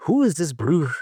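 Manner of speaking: a light mock of the DJ's accent; mimicked friend's accent. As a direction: put on a hint of the friend's accent, playful. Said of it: "you know, mimicking his accent".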